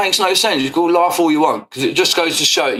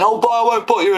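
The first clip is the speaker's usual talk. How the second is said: mocking his words; 7 semitones higher than usual. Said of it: "when he was saying".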